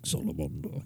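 deep mock-British voice. Shown as a demonstration. Co-host mocking the British salamander, deep fake-British voice. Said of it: "Making your voice deep doesn't make it British".

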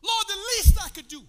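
high, loud, drawn-out preaching cry; impassioned preaching. Real, not acted. Preacher tells church of Christ's suffering, crying out passionately. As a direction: cry out each phrase with long pauses between. Preaches the crucifixion at full force.

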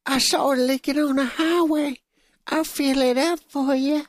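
high, leering creepy stranger, slowing at the end; creepy man voice. Pretend. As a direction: oily offer of help. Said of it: "[name], give us the voice".

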